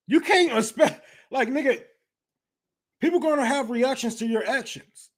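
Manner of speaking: ordinary talk, nothing marked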